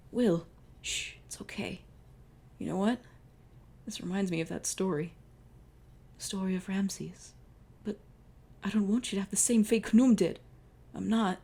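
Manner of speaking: lightly voiced wounded lover; tender dying lover. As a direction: comfort him with your last breath.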